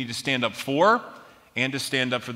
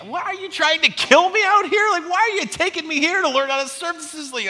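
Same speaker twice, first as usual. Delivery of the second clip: high mock whine, much higher; whining; 5 dB louder than usual. Complaining to his buddy Mike about the rocky beach, mock whine. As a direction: whine like a sulky kid. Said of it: "I'm whining, I'm complaining".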